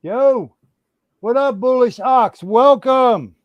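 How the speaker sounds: loud, high hype welcome; excited greeting. Real, not acted. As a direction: hype up the arriving guest. Host greets guest joining stream.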